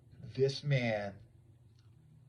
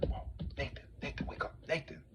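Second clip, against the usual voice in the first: hushed urgent whisper; 4 semitones higher, 6 dB quieter, faster than usual. Retold or imitated. Narrator secretly waking his friend Nathan, urgent whisper. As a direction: rouse your friend without being heard.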